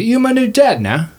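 high-pitched, eager orphan; orphan to new dad. Acted. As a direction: announce it high, as an adopting orphan. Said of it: "He looks at you, Bogey".

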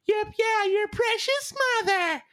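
high squeaky stepsister mimicry; shrill-pitched cartoon sneer; exaggerated cartoon intonation. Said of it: "the two evil stepsisters go".

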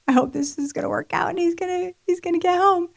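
high, soft worried self-talk; high-pitched tentative wishing; anxious hoping. Retold or imitated. Voicing her own anxious hope.

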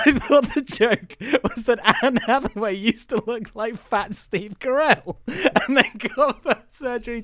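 real laughter bubbling through the words; laughing while talking. Real, not acted. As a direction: struggle to get it out through laughter. Cracking up over misunderstood joke.

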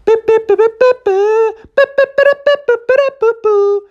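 very high, loud dial tones; rapid-fire squeaked dialling noises; imitated phone beeps. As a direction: beep like a phone keypad.

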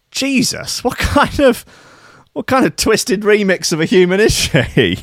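high, incredulous exclamation; shocked disbelief. Real, not acted. Reacting to the toe-thumb surgery story with high, shocked disbelief. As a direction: gasp it out in disbelief.

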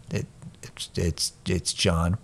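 low, soft, halting answer; sheepish. Retold or imitated. Chastened customer giving his name, sheepish and hushed. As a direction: stammer it quietly, slowing down.

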